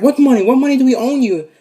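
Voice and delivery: high, loud bewildered questions; baffled protest. Acted. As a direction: protest loudly in disbelief. Family protests to the robber.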